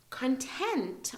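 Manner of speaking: CONTENT with a high, strong second syllable; quick CON, emphatic TENT in CONTENT; stress on TENT. Shown as a demonstration. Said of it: "emphasis on the, the next part".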